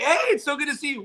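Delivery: high-pitched, effusive hello; gushing greeting. Retold or imitated. Staff greeting him at the dance show, gushing. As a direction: gush briefly before moving on.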